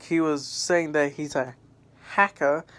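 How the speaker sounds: normal talking voice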